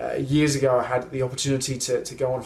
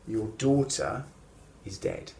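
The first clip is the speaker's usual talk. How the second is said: hushed grave news; 6 semitones lower, 6 dB quieter than usual. Retold or imitated. Messenger brings news of death.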